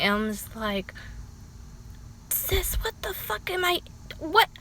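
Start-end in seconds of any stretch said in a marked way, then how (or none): 2.3-4.5 high, hushed "what the fuck"; lost for words in disbelief; baffled inner voice. Retold or imitated.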